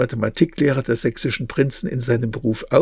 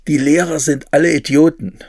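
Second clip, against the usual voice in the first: louder, indignant schoolboy's outburst; passionate outburst; 3 semitones higher, 7 dB louder than usual. A narrator's reading. Son storming in to his mother about school, passionate outburst. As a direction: burst out against the teachers. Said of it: "Ausbrüche gegen die Schule wurden noch leidenschaftlicher".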